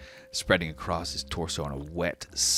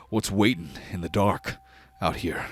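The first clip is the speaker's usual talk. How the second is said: orc voice; 3 semitones higher, 6 dB louder than usual. Acted. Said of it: "the orc takes a half step forward and says".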